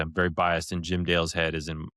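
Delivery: usual speaking voice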